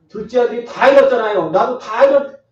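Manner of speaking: fervent loud preaching burst; emphatic preaching. Real, not acted. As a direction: drive it home at full force.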